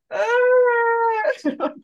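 sharp high loud "Ah!" of being hit by it; a stricken, loud yelp; pained cry. Retold or imitated. Mother hit by her son's question, high loud yelp.

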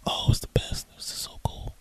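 breathy hush of delight, barely voiced; hushed aside. Real, not acted. Telling viewers how cool it was, hushed. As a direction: whisper it like a treasured secret. Gleeful aside about the incident.

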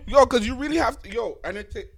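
talking while eating; words round a bite of food; mouth full. Real, not acted. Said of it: "sorry, I'm eating".

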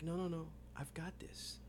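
soft, breath-light mock confidence; hushed reassurance. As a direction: wave them off under your breath. Acts the hero waving others off.

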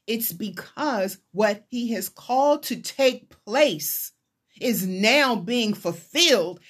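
ordinary talk, nothing marked

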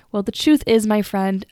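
usual speaking voice

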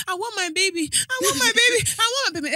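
high, whiny mock tantrum; imitated overwhelmed toddler. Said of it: "she's overwhelmed with something".

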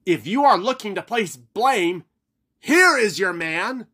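Haggerty proclaiming before the leaders. Acted. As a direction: point the blame out grandly. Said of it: "Haggerty proclaimed".

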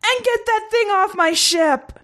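very high, slow, strained command; shouting orders. A narrator's reading. Collapsing commander ordering corpse removed, strained shout. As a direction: squeal the order out slowly. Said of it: "the commander continued to shout orders".

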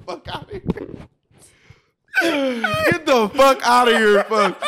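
slow, high words squeezed out through real laughter; laughing hard. Real, not acted. Friends cracking up, cursing through helpless laughter. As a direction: gasp it out while cracking up.

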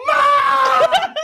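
high, loud, laughing cry for Mom; mock yell for mom. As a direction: scream for mom, half-laughing. Sister calling mom during hold.

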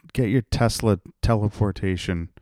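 normal talking voice

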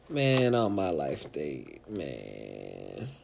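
ordinary talk

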